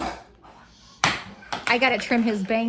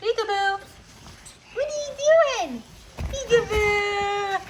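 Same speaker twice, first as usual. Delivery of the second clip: peekaboo play; 8 semitones higher than usual. Pretend. Mother squealing peekaboo at baby, very high.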